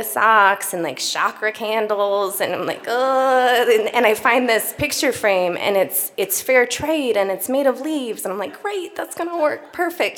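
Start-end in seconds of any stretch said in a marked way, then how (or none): 2.9-3.7 disgusted groan. Retold or imitated. Disgusted at the gift aisle. 8.6-10.2 sarcastic. Retold or imitated. Picks leaf frame ironically.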